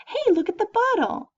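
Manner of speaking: high sing-song, proper words; infant-directed speech. Shown as a demonstration. Teacher shows infant-directed speech to students, high cooing. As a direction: point out the bottle in a high cooing voice. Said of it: "And that's infant-directed speech".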